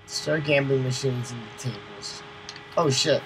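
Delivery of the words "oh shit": normal talking voice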